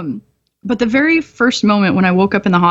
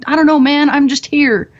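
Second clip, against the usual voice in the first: dopey shrug; 7 semitones higher than usual. Retold or imitated. Drugged patient answering doctors.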